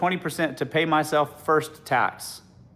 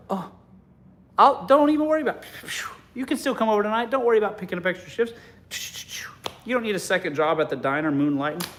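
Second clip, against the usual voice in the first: high, fast and eager to reassure; mock boyfriend gushing to pay; 6 semitones higher, faster than usual. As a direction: rush to comfort her, pitched up. Voicing the generous boyfriend.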